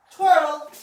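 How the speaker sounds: high, loud one-word cue; dog command. Real, not acted. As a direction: call out the trick cue to your dog. Calling a trained cue to dog.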